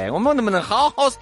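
usual speaking voice

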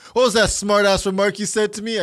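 mock challenge, high and loud; loud boss. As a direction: imitate a boss demanding an answer. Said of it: "and he's loud".

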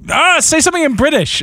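mimicking friends who demand an accent; mock pushy asker. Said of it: "So now when people say".

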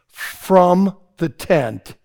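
slow drawn out. Acted. Slow first take before loud repeat.

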